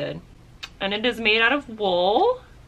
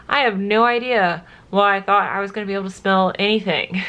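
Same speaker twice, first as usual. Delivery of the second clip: normal talking voice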